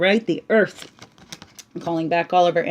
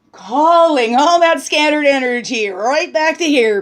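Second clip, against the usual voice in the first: ritual invocation; 7 semitones higher, 5 dB louder than usual. Real, not acted. Calling in the directions ritual.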